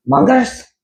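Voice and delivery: high, loud summoning shout. Acted. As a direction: call the henchmen sharply. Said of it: "Doctor Franken shouted".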